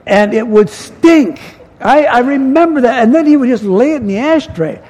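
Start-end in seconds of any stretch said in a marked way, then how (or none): none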